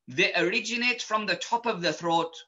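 ordinary talk, nothing marked

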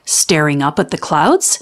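usual speaking voice

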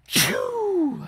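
sharp gasp then a loud sneeze; real sneeze bursting out; high gasp into a big sneeze. Real, not acted. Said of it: "I sneezed".

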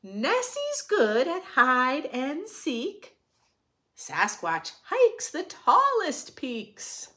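lively, sing-song picture book reading; reading to children. A narrator's reading. Reading a rhyming picture book to children, sing-song. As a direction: read it brightly to the little ones.

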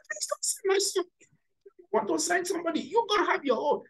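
high-pitched mock rebuff; haughty refusal. Penina refuses to let Hannah send her son, haughty refusal. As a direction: act out a rival wife's quick, high-pitched put-down.

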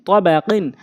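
short vowels, syllables run together; quick reading. Shown as a demonstration. Teacher showing how it must be read, quickly. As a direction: read it briskly as one word. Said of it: "দ্রুতটা উচ্চারণ করতে হবে".

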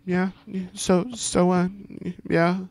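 high, flustered stammer; bashful, halting high voice; blushing. A narrator's reading. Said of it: "I said, blushing".

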